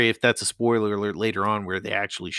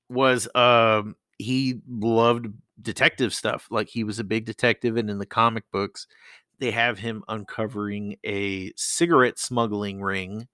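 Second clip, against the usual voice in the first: usual speaking voice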